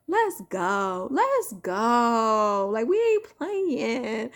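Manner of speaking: loud rallying call, last words stretched out slowly; hyped-up shout. Real, not acted. Rallying his viewers while holding his son, a loud hype call. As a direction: fire up the crowd. Hyping up viewers, baby in arms.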